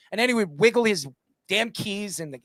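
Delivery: ordinary delivery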